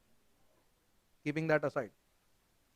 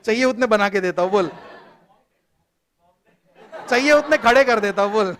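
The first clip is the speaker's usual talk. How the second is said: playful loud boast; 2 semitones higher, 8 dB louder than usual. Real, not acted. Teacher playfully boasts to class.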